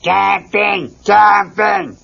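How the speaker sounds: loud wild shout; bellowed. Acted. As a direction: bellow it like the drummer Animal. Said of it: "bellowed Animal".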